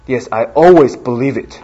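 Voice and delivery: 慢慢地、非常认真地说; 眼神严肃，一字一句地回答; 认真回答. Retold or imitated. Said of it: "我很认真的说". (郑重诚恳、放慢的回答)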